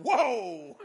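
loud, high exclamation of alarm, played for laughs; mock startled cry. Preacher retelling his shock at a church's exercise, comic alarm. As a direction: blurt out an exaggerated recoil.

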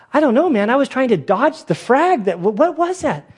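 high, alarmed, rattled; startled. Retold or imitated. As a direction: yelp it like you just dodged shrapnel. Steel plate almost hits him.

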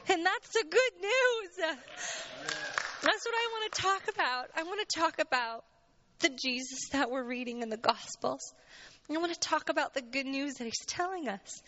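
holding back tears as she speaks; tearful. Real, not acted. Woman sharing her faith journey with church, tearful. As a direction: voice rising high on the edge of tears. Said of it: "I'm sorry if I cry".

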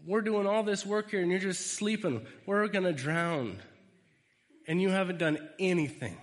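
a disciple yelling at Jesus, frustrated; indignant shouting. Acted. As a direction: scold him for sleeping through it. [name] berates sleeping Jesus.

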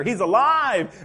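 preaching the joy at full force; excited, loud and high. Retold or imitated. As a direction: burst out with the women's excitement. Women excited at empty tomb.

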